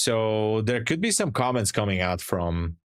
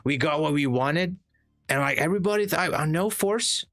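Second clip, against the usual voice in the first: higher, boastful Trump voice rambling about beautiful boats; hammed-up Trump bragging, pitched up; streamer's comedy sketch as Trump, higher and boastful; Trump impersonation; 4 semitones higher than usual. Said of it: "I did a great Trump, Trump impersonation".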